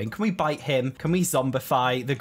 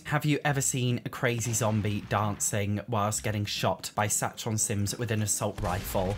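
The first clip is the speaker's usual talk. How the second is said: normal talking voice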